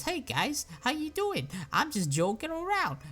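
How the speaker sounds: very high, silly sing-out voice; mock-innocent and squeaky high; Reaper. Reaper unmasked to the gang, comically sheepish. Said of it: "Reaper takes off his mask and says".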